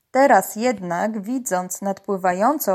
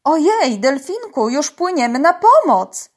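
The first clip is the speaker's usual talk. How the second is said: high, loud exclamation; eager alarm; 6 semitones higher, 7 dB louder than usual. A narrator's reading. Tola answers the dolphin's plea, excited and urgent. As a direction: call back eagerly, promising rescue.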